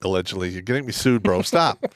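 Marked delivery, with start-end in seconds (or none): 1.4-1.9 raised pitch, breaking into laughter; protesting. Real, not acted. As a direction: protest through rising amusement. Begging co-host to stop the joke.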